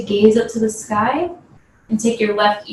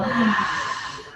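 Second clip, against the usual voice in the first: dramatic sigh; 6 dB quieter than usual. Shown as a demonstration. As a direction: let all the air out in a big sigh. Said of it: "big sigh of relief".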